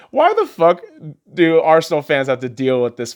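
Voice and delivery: high, loud outraged burst; exasperated rant. Real, not acted. Fan ranting at Tottenham supporters, loud and outraged. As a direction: explode at the rival fans.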